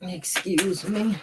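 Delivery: just swallowed a sip; while sipping a drink. Real, not acted. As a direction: excuse me after a gulp of soda. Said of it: "I'm sipping on a little Mountain Dew".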